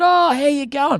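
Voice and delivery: high-pitched hello of recognition; acted friendly greeting. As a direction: greet someone you have just recognised. Re-enacts greeting the sister.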